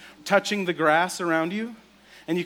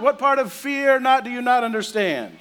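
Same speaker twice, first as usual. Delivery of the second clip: exasperated; 7 semitones higher, 6 dB louder than usual. Retold or imitated. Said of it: "fed-up angel remark".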